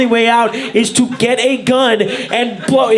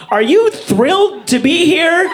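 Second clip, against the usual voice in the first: mock ad pitch; 6 semitones higher than usual. Pitching a pill to happy people, mock upbeat. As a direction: sell it like a cheery pill ad. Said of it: "The commercial should be".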